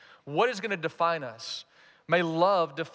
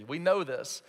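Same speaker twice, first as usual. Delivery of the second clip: ordinary talk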